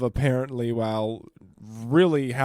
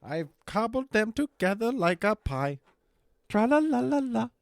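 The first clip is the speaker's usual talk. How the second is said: silly mocking voice; 8 semitones higher than usual. Voicing the author mockingly.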